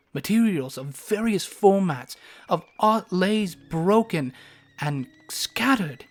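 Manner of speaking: ordinary delivery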